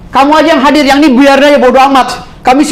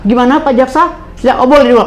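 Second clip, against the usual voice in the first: usual speaking voice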